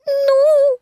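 very high little squeak, plaintive; plaintive Piglet. A narrator's reading. Piglet defending his idea to Eeyore, plaintive high squeak. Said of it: "сказал Пятачок жалобно".

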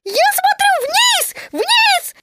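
high, squeaky loud cry; very loud Piglet shout. A narrator's reading. Piglet shouts so the jaguar hears, very loud. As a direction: shout it high, drawing out the words. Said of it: "закричал Пятачок очень громко".

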